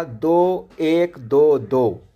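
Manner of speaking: दो एक दो दो counted out slowly, beat by beat; slow meter count. Shown as a demonstration. Teacher slowly counting the meter pattern for students. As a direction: tap out दो एक दो दो slowly. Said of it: "आपने देखा".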